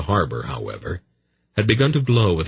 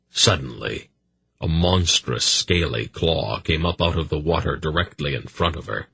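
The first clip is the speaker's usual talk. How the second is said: usual speaking voice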